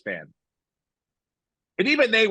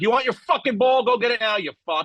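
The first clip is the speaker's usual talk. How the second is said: angry yell; 7 semitones higher, 3 dB louder than usual. Retold or imitated. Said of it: "and been like".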